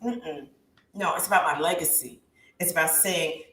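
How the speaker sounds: ordinary talk, nothing marked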